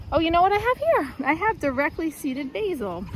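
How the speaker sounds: like spotting a surprise; excited discovery. Real, not acted. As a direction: pitch jumps high with delight. Discovering basil in garden.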